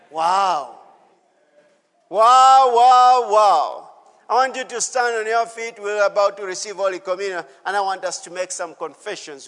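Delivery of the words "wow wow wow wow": loud, drawn-out wonder at the scripture; amazed wow. Real, not acted. Preacher marvelling aloud at the verse, loud amazed wow. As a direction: let it burst out in awe.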